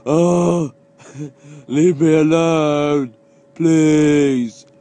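loud, slow, drawn-out mock plea; loud slow mocking whine. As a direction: ham up a whining plea. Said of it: "as they go".

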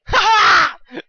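loud high-pitched laugh; real triumphant "ha ha"; gleeful cackle. Real, not acted. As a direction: crow with laughter over the goal. Celebrates a goal in game.

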